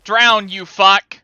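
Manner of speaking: slow, loud furious shout; angry yell. Real, not acted. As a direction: bellow it slowly in rage.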